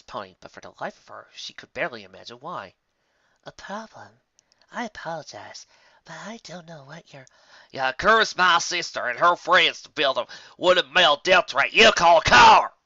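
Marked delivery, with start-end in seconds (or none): 3.4-7.3 fading to a whisper, higher; nervous. Acted. Sandalwood fades to a whisper before the angry pony. 7.7-12.7 slow, much louder furious shout; angry outburst. Acted. Applejack cuts her off, shouting furiously. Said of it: "Applejack interrupted".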